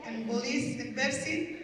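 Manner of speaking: normal talking voice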